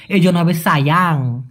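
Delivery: ordinary delivery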